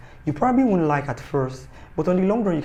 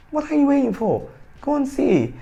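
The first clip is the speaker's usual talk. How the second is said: high, loud excited push; excited urging; 12 semitones higher, 4 dB louder than usual. Real, not acted. Vlogger urges viewers to watch season three, excited. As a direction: urge them on, much higher.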